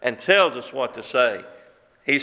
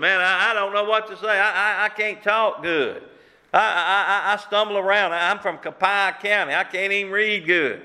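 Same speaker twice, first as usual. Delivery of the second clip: higher, stumbling, pleading excuses; stammering Moses; 5 semitones higher than usual. Acted. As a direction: play a tongue-tied Moses begging off. Moses making excuses to God.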